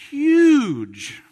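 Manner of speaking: a big swell on the word huge; emphatic huge. Real, not acted. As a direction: land hard on huge. Preacher stressing size of claims.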